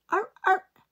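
very high, loud seal barks. Acted. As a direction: bark like a happy seal. Seals barking in story.

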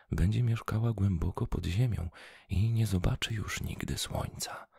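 ordinary delivery, nothing marked

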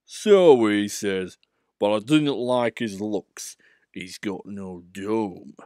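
slow, heavy suspicious big engine; dark Gordon. Acted. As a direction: say it darkly and slowly. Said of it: "said Gordon darkly".

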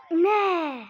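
sharp loud cry of no; shout. Real, not acted. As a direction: yell it in dismay. Shout at goal.

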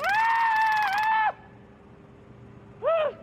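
a sharp, loud, high-pitched shriek; sudden squeal of alarm; startled high yelp. Real, not acted. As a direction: yelp out in shock. Sudden yelp while being cuffed.

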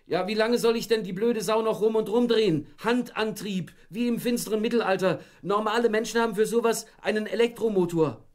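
very high, loud complaining yell; annoyed yelling. Acted. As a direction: yell the complaint across the garden. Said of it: "wieder ein Schrei, diesmal von Stefan".

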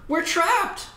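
high, loud cry of panic; trapped and terrified, crying out; frightened yell. Acted. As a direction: yell it like you're cornered. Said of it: "someone yelled".

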